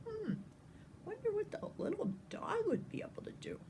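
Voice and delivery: fast, soft aside whisper. Real, not acted. Reader musing to the listening child, whispered. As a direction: lean in and wonder in a whisper. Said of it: "aside whisper to child".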